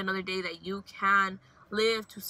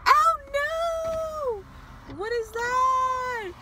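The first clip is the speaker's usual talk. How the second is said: startled fright; 12 semitones higher, much slower than usual. Real, not acted. Driver spotting a bug on her window, shrieking in fright. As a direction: shriek at the thing on the window, drawn out.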